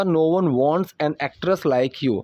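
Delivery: slow model sentence. Shown as a demonstration. Teacher models slow English line.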